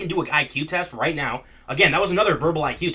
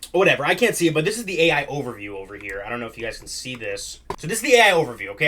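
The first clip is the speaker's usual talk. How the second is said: ordinary talk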